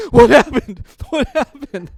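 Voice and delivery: confused. Retold or imitated. Both boys waking confused.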